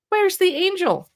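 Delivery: enthusiastic read-aloud. Shown as a demonstration. Reading the baby book to an infant, high eager question. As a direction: ask it like a game for an infant. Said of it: "read it with an enthusiastic tone of voice".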